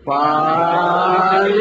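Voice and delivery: a long, sung, sickly call stretched very slowly; husband's drawn-out call. Retold or imitated. Sick husband calling his wife from bed, long and sung. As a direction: groan her name out like a feeble patient.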